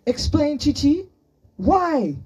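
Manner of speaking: high, loud interrogation; angry demand. A narrator's reading. Boy demanding his friend explain herself, angrily shouting.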